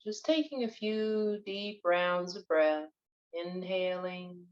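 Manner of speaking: unhurried, even-toned breath coaching; guided meditation. Acted. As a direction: guide listeners through breathing, drawing out key words.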